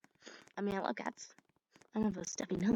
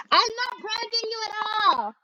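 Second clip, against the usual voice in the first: innocent; 11 semitones higher than usual. Pretend.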